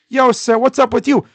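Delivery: acted passer-by accosting someone; loud confronting bystander. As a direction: loudly call out a challenge. Acted neighbour confronting the yeller.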